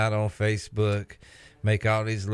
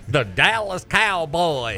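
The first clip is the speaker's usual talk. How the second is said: put-on slow, louder Southern-accent drawl; 6 semitones higher, 3 dB louder, slower than usual. Host announcing the Cowboys in a mock Southern accent. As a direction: stretch it out like a proud Southern fan. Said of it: "you gotta throw in the Southern accent".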